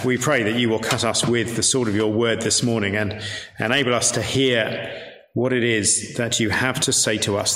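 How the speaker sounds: usual speaking voice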